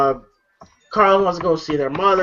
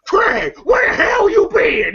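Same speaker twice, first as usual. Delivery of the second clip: high, drawn-out imitated yell; John Witherspoon; 12 semitones higher, 3 dB louder, slower than usual. Father yelling at Craig, imitated scolding shout. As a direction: scold like an exasperated movie dad. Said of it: "supposed to be yelling at him".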